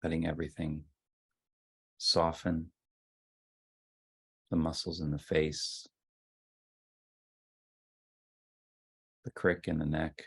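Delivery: soft, unhurried voice with long silences; guided meditation. Real, not acted. Leader settling the group into ten minutes of sitting, guided meditation. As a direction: ease them gently into stillness, phrase by phrase.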